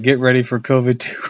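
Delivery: loud hammed-up trailer voice through a laugh; laughing announcer. Real, not acted. Viewer jokes about a sequel, laughing loudly. Joking movie title.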